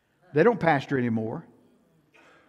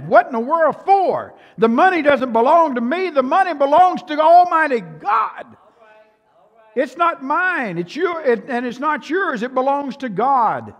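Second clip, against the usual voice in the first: louder and higher, driving each line home; full-force preaching; 10 semitones higher, 8 dB louder than usual. Acted. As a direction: preach it out hard to the congregation.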